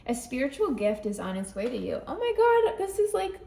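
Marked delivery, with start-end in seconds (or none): 2.1-3.4 a sudden high, loud burst of delight; thrilled as the card hits home; excited gasp. Real, not acted. Reading tarot for viewers, thrilled by the card's message.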